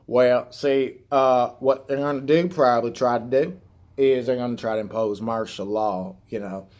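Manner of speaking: a hint of Appalachian twang; appalachian accent. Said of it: "your fucking hillbilly accent".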